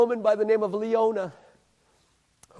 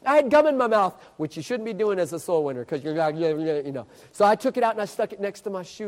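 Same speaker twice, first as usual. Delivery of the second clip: usual speaking voice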